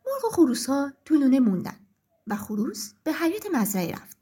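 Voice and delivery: ordinary delivery, nothing marked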